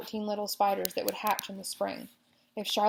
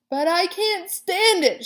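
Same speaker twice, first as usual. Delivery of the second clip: high, loud, slow anguished shout; 11 semitones higher, 10 dB louder, slower than usual. A narrator's reading. Pig refuses to leave spider, shouting. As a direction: shout it out in despair. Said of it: "shouted Wilbur".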